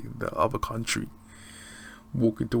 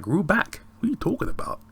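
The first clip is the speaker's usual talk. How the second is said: much higher, mock disbelief; incredulous; 10 semitones higher, 4 dB louder than usual. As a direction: play the baffled fish shop lady. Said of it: "She like".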